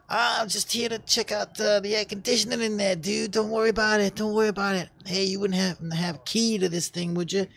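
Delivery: high, put-on innocent voice; mock bluffing intruder. Acts out intruder bluffing the guard.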